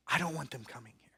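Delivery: fast hushed whisper; whispered plea. Acted. Rich man whispering his fear of hell. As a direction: whisper it urgently.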